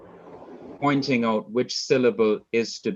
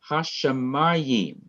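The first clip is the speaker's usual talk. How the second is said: Hashemayim drawn out slowly, stressed on MA; stress on second-last syllable; 5 semitones higher, slower than usual. Shown as a demonstration. Teacher showing the Hebrew accent, Hashemayim stressed before the last syllable. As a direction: lean on the MA in Hashemayim. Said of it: "the accent is on the second to the last syllable".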